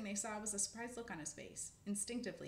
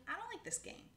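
high small squirrel voice; high unhappy squirrel; Dottie's voice; 13 semitones higher than usual. Acted. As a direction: say it high like a scared child.

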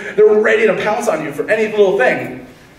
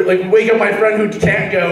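usual speaking voice

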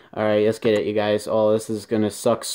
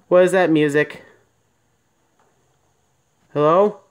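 real fright, loud and higher; alarmed call into the dark; frightened; 5 semitones higher, 3 dB louder than usual. Real, not acted. Strange music in horror game.

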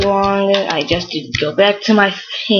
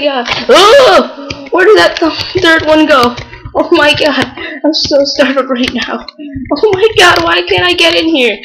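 high, loud frantic cries; panicked shouting; 8 semitones higher, 3 dB louder than usual. Real, not acted. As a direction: cry out in real panic. Losing items to explosions in game.

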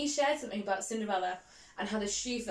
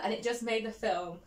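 ordinary delivery

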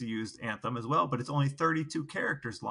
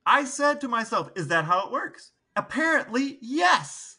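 excited; 8 semitones higher than usual. Real, not acted. Excited about odd anthem facts.

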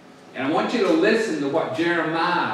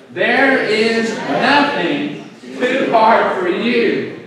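loud, very slow, raised declaration; rallying recitation; 6 semitones higher, 6 dB louder, much slower than usual. Shown as a demonstration. Preacher saying last line together with church, rallying recitation. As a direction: lead the crowd word by word.

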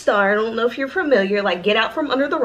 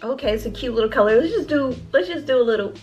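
usual speaking voice

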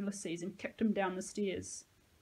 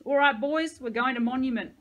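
calling out; 4 semitones higher, 9 dB louder than usual. Acted. Leader calls out to his gang.

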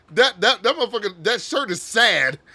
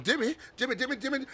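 higher-pitched mimicry of a cartoon boy; high mock character voice; imitating cartoon boy; 7 semitones higher, 6 dB quieter, much faster than usual. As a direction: mimic the boy repeating his one word. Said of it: "He's like".

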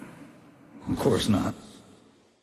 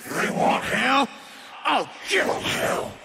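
shout. Acted. Game voice lines played back.

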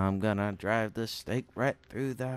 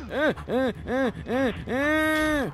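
high, loud frightened panting; startled shaky cries after a scare; panicked gasps; 13 semitones higher, 8 dB louder, much slower than usual. Real, not acted. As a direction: gasp out in real terror.

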